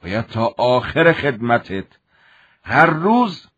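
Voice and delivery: a hint of a threatening officer; stern commander. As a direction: lay out the punishment coldly.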